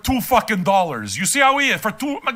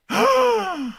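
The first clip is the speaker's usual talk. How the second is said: exaggerated shocked gasp; big, drawn-in gasp of fake shock; high, theatrical gasp; mock gasp; 11 semitones higher than usual. Mock shock at having to pay.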